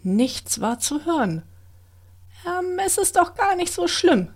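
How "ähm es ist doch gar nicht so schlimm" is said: put-on high, small girl voice talking herself brave; timid wood-elf girl, higher; nervous self-encouragement. A narrator's reading. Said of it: "sprach das Waldwichtelmädchen sich selbst Mut zu".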